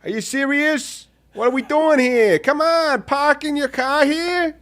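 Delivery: high-pitched, indignant Boston accent. Shown as a demonstration. Host plays upset Bostonian over parking, high Boston accent. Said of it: "Can you do a Boston accent?".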